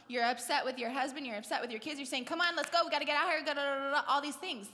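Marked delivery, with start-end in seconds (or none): 2.2-4.7 hurrying the family. Retold or imitated. Stressed parent to the kids, loud hurried chivvying. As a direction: chivvy everyone out, louder and urgent.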